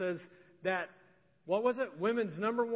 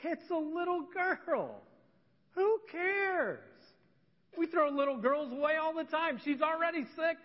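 mock dismissive voice, pitched up high; dismissive crowd; 8 semitones higher than usual. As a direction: play a scoffing crowd waving it off, high-pitched. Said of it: "the culture was like".

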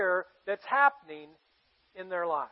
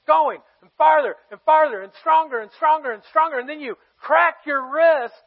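rising louder with each phrase; building excitement; 6 semitones higher, 7 dB louder than usual. Acted. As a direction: build it up like a swinging sling. Sling swinging faster and stronger.

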